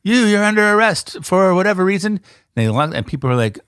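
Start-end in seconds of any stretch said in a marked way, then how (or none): 0.0-2.2 cop voice. Retold or imitated. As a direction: play the arresting officer. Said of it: "They said".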